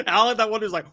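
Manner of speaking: usual speaking voice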